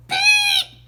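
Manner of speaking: piercing, very high and loud squeak; highest annoying chirp. A narrator's reading. Boy's loudest blackbird chirp at the teacher, piercing squeal. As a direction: blast the shrillest chirp you can. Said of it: "the highest, most annoying chirp".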